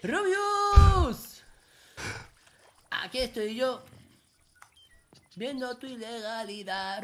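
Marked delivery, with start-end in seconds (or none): 0.0-1.4 high, loud drawn-out call; piercing sing-out call. Pretend. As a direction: holler a friend's name, stretching the end. Calling out to his friend.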